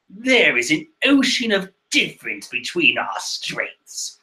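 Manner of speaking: affected villain voice; Perrowan. Acted. Villain boasting of superior strength, affected voice. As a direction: gloat in a put-on character voice. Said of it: "says Perrowan".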